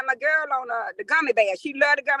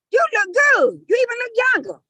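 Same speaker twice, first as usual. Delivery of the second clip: daughters' compliment; 10 semitones higher, 3 dB louder than usual. Retold or imitated. Daughters complimenting mom, retold.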